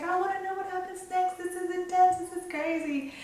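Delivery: a hint of a gushing girl at the counter; excited ticket agent. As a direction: imitate the agent's thrilled high voice. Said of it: "'cause she's like".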